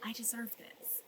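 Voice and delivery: a soft breathy whisper to herself; hushed, almost voiceless self-affirmation. Real, not acted. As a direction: say it under your breath, like a secret you're only now allowing.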